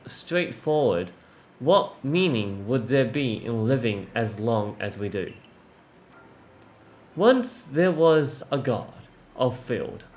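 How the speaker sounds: paced read-aloud, pausing between phrases; story narration. Acted. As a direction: read the old verse and dialogue unhurriedly. Reads game dialogue aloud.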